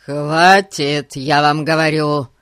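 loud, forceful command; angry shout. A narrator's reading. Гун advances on the old man, shouting. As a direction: shout it as you advance on him. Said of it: "крикнул Гун, надвигаясь на него".

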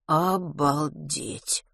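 very slow, quiet amazement; stunned disbelief. A narrator's reading. Boy stunned after mother disowned him, dazed. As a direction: stretch it out, dumbfounded.

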